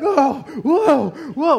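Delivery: high, loud startled cries; comic panicked whoa; mock alarm. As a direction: yelp as if wrestling a squealing piglet. Acting out pinning a screaming piglet.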